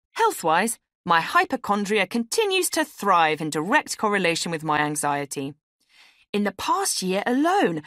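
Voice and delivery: perky round-robin cheer with a sarcastic edge; chirpy email writer. Acted. A woman reading her Christmas email to all, perky.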